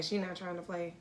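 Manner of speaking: ordinary talk, nothing marked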